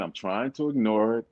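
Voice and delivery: ordinary talk, nothing marked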